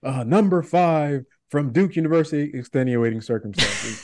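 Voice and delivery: a hint of an arena announcer; lightly voiced PA announcer. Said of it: "the announcements for the Rockets".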